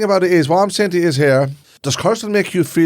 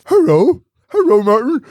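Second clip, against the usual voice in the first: squeaky animal voice; 8 semitones higher, 4 dB louder, slower than usual. Pretend. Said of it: "Talk like a dog".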